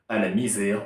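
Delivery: ordinary talk, nothing marked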